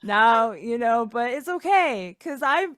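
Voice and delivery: Kevin, loud and upbeat, consoling; chirpy surfer-type friend cheering them up; Kevin in character. Acted.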